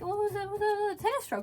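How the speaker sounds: high-pitched, stammered self-defence; flustered defence. Retold or imitated. Player explaining the stroke to the director, flustered.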